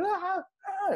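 high-pitched mock baby wail; imitated baby cry. Dad mimicking his baby crying at night, imitated high wail. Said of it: "he just crying like".

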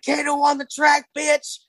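high shouted producer tag; shouting. Retold or imitated.

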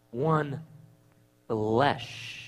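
hushed, drawn-out words; solemn scripture reading. Real, not acted. Preacher repeats the verse after "what does that mean", solemn and hushed. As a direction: let each word hang softly. Answers own question with verse.

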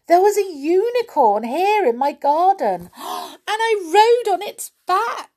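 high, thrilled calling out; Little Miss Sunshine, excited. Acted. Garden owner telling of her unicorn ride, thrilled. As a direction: burst with wonder, gasping midway. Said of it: "called little Miss Sunshine".

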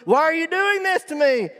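high, loud wail of protest; hammed-up high doggy outcry; betrayed dog. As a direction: plead like a wronged little pet. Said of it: "she's shaking ... she looks at".

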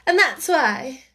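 haughty duchess voice; lofty noblewoman, condescending; imperious plummy grande dame. A narrator's reading. Duchess voice in story.

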